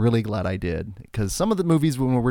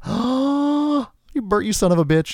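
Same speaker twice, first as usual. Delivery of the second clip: delighted exclaim; 11 semitones higher, slower than usual. Retold or imitated. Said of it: "I was like".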